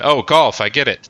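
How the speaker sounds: ordinary talk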